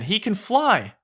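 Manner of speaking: high-pitched acted wonder; amazed. Peter Pan amazed at flying donkey.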